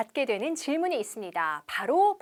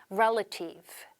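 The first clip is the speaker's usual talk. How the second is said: "relative" with first-syllable stress, REL-a-tive; correct pronunciation; 4 semitones lower, much slower than usual. Shown as a demonstration. Teacher modelling "relative" with stress on the first syllable. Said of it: "일강세".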